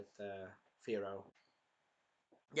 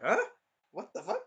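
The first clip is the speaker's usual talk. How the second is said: high-pitched squeak of confusion; mock bafflement; 9 semitones higher than usual. Naofumi confronted with the girl, high mock-baffled reaction. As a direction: squeak it in disbelief.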